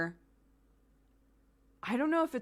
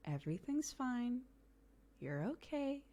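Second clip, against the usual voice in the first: calm soothing; 5 semitones higher, 7 dB quieter than usual. Shown as a demonstration. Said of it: "you need to be the calm for them".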